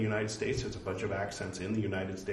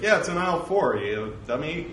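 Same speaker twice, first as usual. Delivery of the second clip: louder, higher scornful store clerk; mock exasperation; 7 semitones higher, 8 dB louder than usual. Store clerk to a confused shopper, mock exasperation. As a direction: play at being fed up with a clueless shopper. Imagined Californian clerk answers him.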